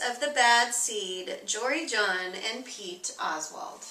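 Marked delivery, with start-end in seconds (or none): none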